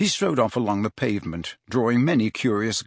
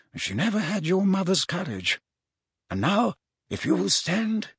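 higher-pitched, kindly wizard voice; measured, gentle mentor; 5 semitones higher than usual. Acted. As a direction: counsel the pupil like a patient mentor. Wizard gently counsels his pupil.

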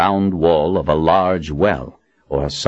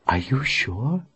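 soft, hushed question; whispering; 7 semitones higher, 6 dB quieter, slower than usual. Acted. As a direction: hush it to him. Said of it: "Verna whispered to Walsh".

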